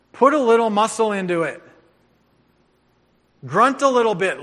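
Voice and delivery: barked coaching orders; coach yelling. Shown as a demonstration. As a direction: drive them like a coach at practice. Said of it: "I used to coach".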